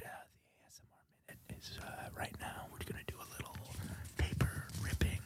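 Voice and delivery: soft breathy ASMR whisper into the mic. Pretend. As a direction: whisper close like an ASMR video. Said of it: "welcome you to the A S M R Minute".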